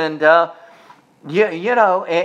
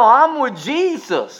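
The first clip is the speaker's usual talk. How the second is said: normal talking voice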